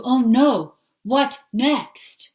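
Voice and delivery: frazzled worrier's outburst; anxious alarm. Retold or imitated. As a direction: throw up your hands in dread. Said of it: "your energy changes from chill".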